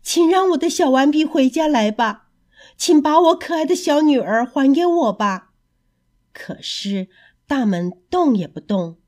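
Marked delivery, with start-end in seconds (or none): 0.0-5.5 sad pleading. Acted. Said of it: "悲伤地叫着".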